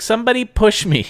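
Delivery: put-on high, whiny toddler demand; little girl voice. Toddler asking someone to push her, high little-girl whine. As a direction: beg like a three-year-old.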